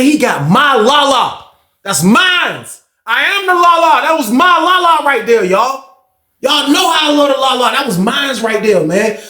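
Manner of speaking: high, drawn-out indignant wail; possessive outrage. Retold or imitated. Acted possessive outrage over his Lala.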